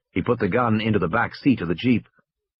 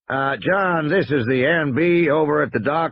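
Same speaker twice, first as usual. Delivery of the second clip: drawl; 6 semitones higher than usual. Acted. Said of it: "a voice drawl".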